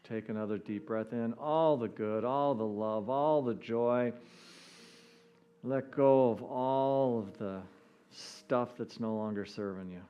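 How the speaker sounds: ordinary talk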